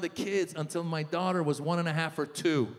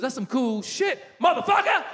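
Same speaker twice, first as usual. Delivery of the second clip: rapper friend; 6 semitones higher, 4 dB louder than usual. Retold or imitated. Rapper friend reacts to piano lessons news, hyped mock shout. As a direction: imitate a hyped rapper, punching the curse. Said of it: "my rapper friend".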